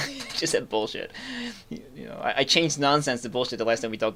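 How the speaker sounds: ordinary delivery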